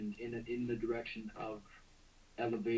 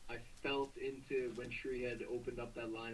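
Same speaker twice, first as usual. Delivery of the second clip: ordinary delivery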